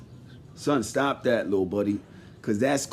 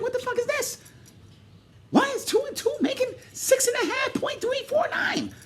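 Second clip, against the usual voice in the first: mock bafflement; 6 dB quieter than usual. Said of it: "I was like".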